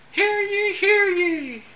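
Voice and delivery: high, loud, slow proclamation; town crier. Acted. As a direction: announce it like a herald boy. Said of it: "Kids get the flair for the dramatic".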